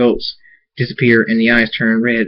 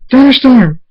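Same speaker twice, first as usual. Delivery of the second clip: high, loud alarmed scream; screamed name; 13 semitones higher, 7 dB louder than usual. A narrator's reading. Twilight sees Thunderstorm buried by wolves, screaming. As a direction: scream the name of a friend in danger. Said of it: "Twilight screams".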